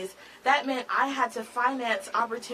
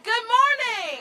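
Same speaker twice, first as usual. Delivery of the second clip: higher and louder good morning; louder greeting; 11 semitones higher, 5 dB louder, slower than usual. Real, not acted. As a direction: push it out to the whole crowd. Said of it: "we can do a little bit better than that".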